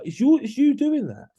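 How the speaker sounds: mucho más agudo y fuerte, acusando; señala al culpable con la voz alta; emphatic outburst. Real, not acted. Blaming lads, peak outrage.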